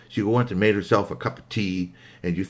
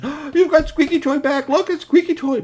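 high, loud exclamation; gasping, high-pitched excitement; amazed delight; 6 dB louder than usual. Acted. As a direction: exclaim like astonished children. Friends amazed at returned toy.

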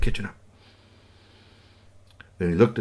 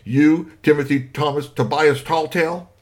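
stern father; 5 semitones higher, 5 dB louder than usual. Acted. Angry father full name.

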